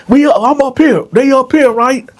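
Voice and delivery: high, loud directing call. Retold or imitated. Guard calling fifteen deputies up to the cell, loud.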